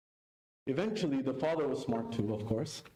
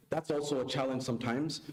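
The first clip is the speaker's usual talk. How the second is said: ordinary delivery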